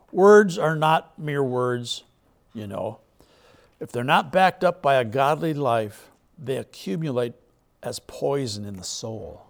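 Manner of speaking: slow and weighty, leaning on each word; emphatic scripture reading. Acted. Preacher driving the verse home to the church, slow and weighty.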